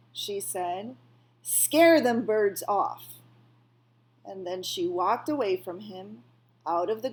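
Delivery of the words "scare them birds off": loud order. A narrator's reading. Old woman ordering the rabbit.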